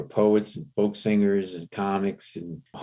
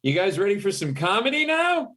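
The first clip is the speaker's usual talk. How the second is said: higher, put-on announcer voice; mock emcee hype, pitched up; club emcee; 10 semitones higher than usual. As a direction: introduce the next act like a club host. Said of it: "hear the M C go up".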